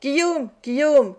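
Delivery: put-on old man crying after the fleeing robber; calling out. A narrator's reading. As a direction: shout after a man running off. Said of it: "cria le juif en courant après lui".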